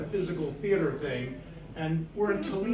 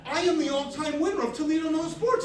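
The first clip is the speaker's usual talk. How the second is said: high-pitched indignant outburst; outraged; 8 semitones higher, 6 dB louder than usual. Retold or imitated. Winner seeing the wrong name on screen, outraged. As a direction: protest in rising, comic outrage.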